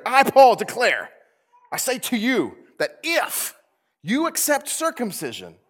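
loud, high, slow proclamation with a laugh; Paul declaring. Retold or imitated. Pastor voices Paul warning the Galatians, loud and laughing. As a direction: proclaim it like an indignant apostle. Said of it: "This is what Paul's saying here".

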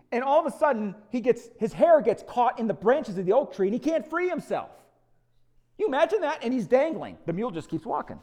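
high, loud, excited retelling; telling the mule story with big energy; animated storytelling. Real, not acted. Preacher telling the Absalom story, excited and loud.

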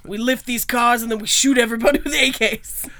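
loud Brother Maxim impression, playing it for laughs; mock Brother Maxim. Imagining Brother Maxim as an action movie lead, loud mock bravado. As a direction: belt it out as a mock action hero. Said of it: "Be like".

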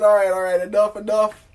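loud, high retold hushing of a crowd; calling for order. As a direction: imitate a judge settling a noisy room. Judge quieting the retold courtroom.

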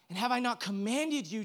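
ordinary talk, nothing marked

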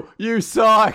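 high, drawn-out mock booing; hammed-up heckle from the audience; heckling. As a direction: jeer like a heckler in the crowd. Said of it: "She starts hackling him".

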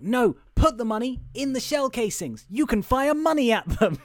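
high, emphatic explaining; acted insistence. Acts out insistent girl explaining plan.

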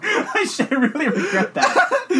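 high, helpless laughing through words; real laughter. Real, not acted. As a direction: laugh in embarrassment while talking. Cracks up after own impression.

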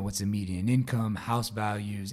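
ordinary talk, nothing marked

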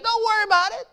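high-pitched, casual mock reassurance; light, high imitation of a carefree prophet; unworried prophet. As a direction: brush off the worry in a high voice. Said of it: "When Elijah said".

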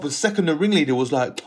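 ordinary delivery

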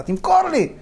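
high, loud demand; re-enacted yell, pushed up in pitch; shouting back. Fed-up buyer shouting back at the owner, high loud yell. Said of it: "ככה, צעקתי עליו חזרה".

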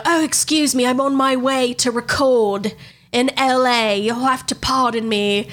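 slow, stretched mock celebrity voice; hammed-up man-as-lady singer, drawn out. Fake singer excuses herself to radio hosts, slow parody voice. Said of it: "you hear this man's voice".

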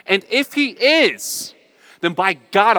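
impassioned preaching. Real, not acted. Preacher declaring choice to believe to congregation, impassioned. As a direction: declare it loudly with defiant resolve.

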